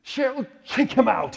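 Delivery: high, loud, slow British call; loud, slow, high mock English host; Charlie's English voice. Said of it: "and he goes".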